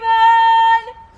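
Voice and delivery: loud high gleeful cackle. Acted. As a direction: burst into a big mocking laugh. Gleeful laugh after insult.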